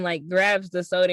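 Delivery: ordinary talk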